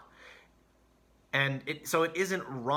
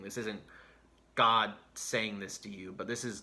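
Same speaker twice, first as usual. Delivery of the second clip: normal talking voice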